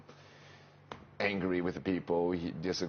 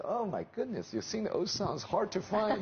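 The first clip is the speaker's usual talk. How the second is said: high-pitched mock astonishment; amazed reaction, retold; 13 semitones higher than usual. Karaoke friends reacting to his old songs, mock amazed. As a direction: imitate onlookers marvelling, voice raised high.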